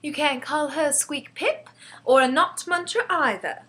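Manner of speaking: put-on high-pitched, mildly indignant girl; cross child defending someone's honour; growing anger. A narrator's reading. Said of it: "getting angrier and angrier".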